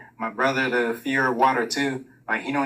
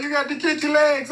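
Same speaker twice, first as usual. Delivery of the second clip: high, pushy father's order; imitating dad's orders; mock stern dad; 12 semitones higher than usual. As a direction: nag the kid to kick. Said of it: "You like".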